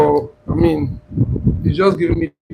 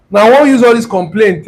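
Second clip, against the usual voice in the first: normal talking voice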